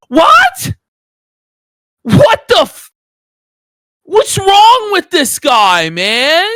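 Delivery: stunned, loud disbelief; shocked yell of disbelief; shocked outburst. Real, not acted. Reacting to shocking statement.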